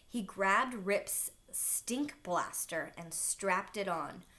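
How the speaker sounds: plain reading voice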